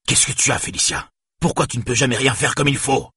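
high, exasperated scolding; yelling. A narrator's reading. Father berating his daughter for never doing things right, yelling. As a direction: let rip as the furious parent. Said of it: "hurla-t-il".

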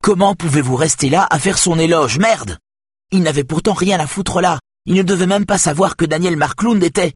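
furious tirade at the boss; rising angry rant. A narrator's reading. Saga's angry outburst at boss.

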